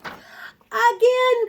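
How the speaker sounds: high loud happy demand; eager cry. Acted. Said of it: "cried Sam".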